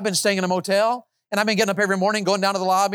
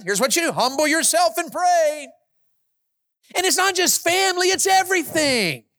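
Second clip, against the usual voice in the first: preaching at full force; 9 semitones higher than usual. Acted. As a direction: drive each question home. Preacher rising again.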